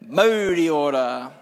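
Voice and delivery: formal chanted Māori greeting, slowing at the end; Māori opening karakia. Acted. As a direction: recite it as a formal ceremonial opening. Māori opening chant before sermon.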